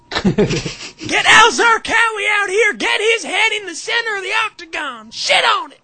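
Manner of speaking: high, loud bloodthirsty shriek; frenzied screaming. Acted. Reserved women losing it at the fights, screaming. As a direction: scream demands at full force.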